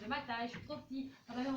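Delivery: ordinary delivery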